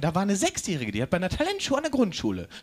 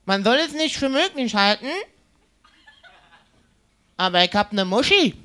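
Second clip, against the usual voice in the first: mock aggressive guy; 4 semitones higher, 3 dB louder than usual. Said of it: "der sagt original".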